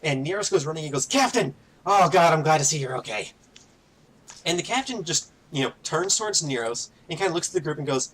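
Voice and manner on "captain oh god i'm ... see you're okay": high, urgent cry of relief; higher-pitched burst of relief; relieved calling out. Acted. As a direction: call out to your captain, relieved. Relieved crewman calls to captain.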